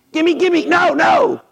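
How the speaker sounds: high, eager gimme gimme; high-pitched hungry mock grabbing; greedy hungry Peter. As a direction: grab at the food.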